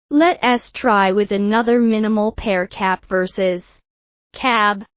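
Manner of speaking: ordinary delivery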